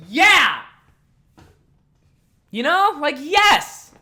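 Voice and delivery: high, loud, joyful cheer; triumphant yell. Real, not acted. Backed up by the old woman, a triumphant yell. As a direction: cheer like you've been vindicated.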